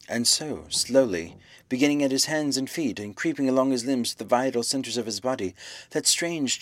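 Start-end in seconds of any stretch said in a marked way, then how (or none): none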